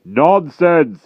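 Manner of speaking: loud, weighty giant verdict; pronounced. A narrator's reading. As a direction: pronounce it like a judge. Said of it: "he pronounced".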